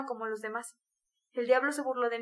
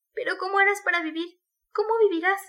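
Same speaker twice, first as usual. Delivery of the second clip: high, loud pressing questions; distraught exclamation; 6 semitones higher, 7 dB louder than usual. A narrator's reading. Sonia asking how he will live, distraught. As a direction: cry out in alarm. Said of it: "Exclamó la joven".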